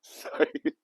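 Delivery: high, helpless laughing apology; real laughing. Real, not acted. Host apologizing to viewers for cracking up, laughing. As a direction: squeeze out one word through laughter.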